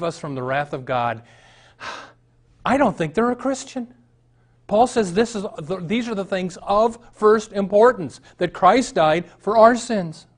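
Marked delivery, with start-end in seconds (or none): 2.7-10.3 high, loud, slowing preacher's cry; high, loud fervent proclamation; preaching at full force. Real, not acted. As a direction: lift it up and proclaim the core. Preaching climax.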